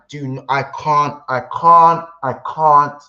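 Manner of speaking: frustrated stammer building to a shout; stuttering. Real, not acted. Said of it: "I'm, I'm stuttering".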